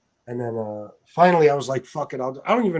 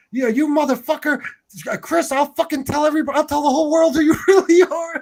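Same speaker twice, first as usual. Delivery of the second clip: very high, ranting impersonation building louder; imitated angry threat; 11 semitones higher, 5 dB louder than usual. Retelling his threats from the chat, mock high-pitched rage with a laugh breaking in. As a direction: mimic a man flipping out with threats. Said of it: "he's like".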